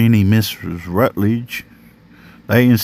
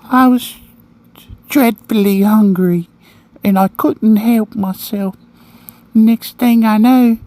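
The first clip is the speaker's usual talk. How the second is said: put-on high, louder English little-boy voice; high-pitched, prim English accent; silly English accent; 12 semitones higher, 4 dB louder than usual. A narrator's reading. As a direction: confess as a proper English child, pitched high. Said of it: "said again in that silly sounding English accent".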